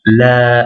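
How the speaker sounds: "La" with a long drawn-out a; prolonged vowel. Shown as a demonstration. Teacher showing the alif's effect, "La" prolonged. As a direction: hold the vowel of "La". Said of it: "c'est la prolongation du a".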